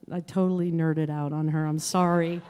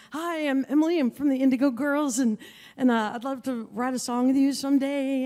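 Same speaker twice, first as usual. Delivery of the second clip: put-on eager young fan; 7 semitones higher, 3 dB louder than usual. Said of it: "and I was like".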